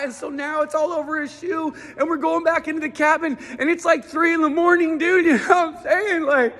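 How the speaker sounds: high, pumped-up stand-up delivery; stand-up routine. Acted. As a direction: sell every bit to the crowd, high and hyped. Performs his stand-up routine.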